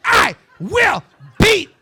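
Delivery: high, loud, slow, hammered-out mom rant; loud, high, slow mock yell of a furious mother. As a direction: scream it like an enraged mother in a Kmart. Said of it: "She was just".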